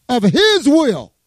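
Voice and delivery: "his" drawn out, high and emphatic; stress on HIS. Real, not acted. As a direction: lean hard and slowly on "his". Corrects misquote, stresses God's ownership.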